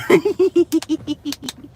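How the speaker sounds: giddy high-pitched laugh; high giggle. Real, not acted. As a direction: burst into a squeaky giggle. Laughs after flirting joke.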